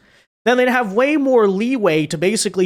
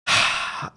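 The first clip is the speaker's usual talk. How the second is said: weary sigh; 5 dB quieter than usual. Real, not acted. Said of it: "I'm exhausted".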